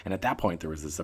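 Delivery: usual speaking voice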